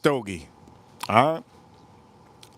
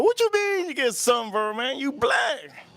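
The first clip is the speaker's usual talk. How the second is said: disbelief; 9 semitones higher, slower than usual. Retold or imitated. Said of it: "I go".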